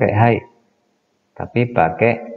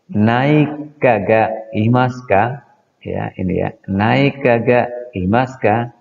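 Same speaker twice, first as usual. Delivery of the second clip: slow model sentence; 3 semitones higher, slower than usual. Shown as a demonstration. Teacher modelling a question for students: slow, clear drill. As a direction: drill it slowly for the class.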